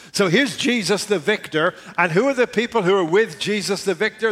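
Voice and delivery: rising fervor. Real, not acted. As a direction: build the excitement.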